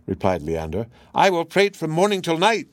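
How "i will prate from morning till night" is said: high, loud chattering parrot. Acted. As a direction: prattle like a cheeky bird. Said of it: "still counterfeiting the parrot's voice".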